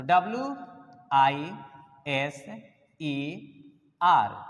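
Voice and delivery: "W I S E R" spelled one letter at a time, with pauses between; correct spelling spelled out. Shown as a demonstration. Teacher spelling the correct word for the class, letter by letter. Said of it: "ये सही word हो गया".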